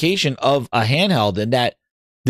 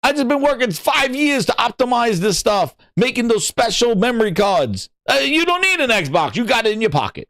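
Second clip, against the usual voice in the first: mock developer quote; 6 semitones higher than usual. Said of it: "tells you at the end going".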